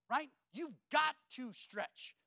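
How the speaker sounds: usual speaking voice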